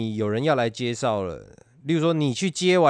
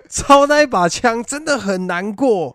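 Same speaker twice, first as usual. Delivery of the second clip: laughing; 11 semitones higher, 8 dB louder than usual. Real, not acted. Still laughing, complaining about the gun.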